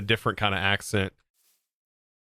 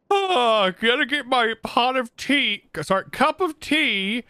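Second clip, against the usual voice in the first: high-pitched mock British accent, hammed up; 12 semitones higher, slower than usual. Mock British accent to tease Beardy about his morning tea. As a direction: squeak out a bad British accent. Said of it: "You gave Beardy about thirty extra teeth with that accent".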